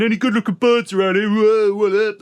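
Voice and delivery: loud, high, rowdy jeering at the room; drunk prisoner shouting. Retold or imitated. Handcuffed man shouting across the waiting room, rowdy jeer. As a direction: bellow it like a cocky drunk in cuffs. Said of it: "He was shouting stuff and saying".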